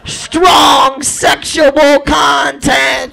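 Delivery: loud, slow savoured reading; slow excited reading. Pretend. Hard-up guy reading movie warnings aloud, loud slow and thrilled. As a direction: stretch each word out, thrilled.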